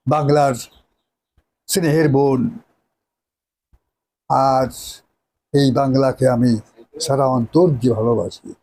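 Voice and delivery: grand theatrical declamation as a nawab; hammy stage actor. Acted. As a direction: declaim it like a tragic stage hero. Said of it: "নায়কের dialogue আলাদা".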